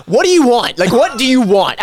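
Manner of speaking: hammed-up exasperated yell; mock angry shout. Retelling Craig's outburst in the casino, mock angry shout. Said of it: "I remember Craig so vividly".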